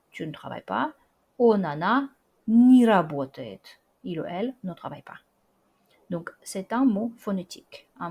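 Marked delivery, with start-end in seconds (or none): 1.4-3.8 я не работаю said slowly and loudly, не as ni joined to the verb; slow clear model. Shown as a demonstration. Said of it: "se prononce comme ni". (я не работаю lent et fort, не prononcé ni, collé au verbe)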